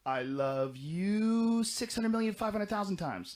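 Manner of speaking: put-on high, slow, sing-song kid voice; imitating a child's gushing love; childlike voice. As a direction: say it like an adoring little kid. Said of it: "something like a kid would say".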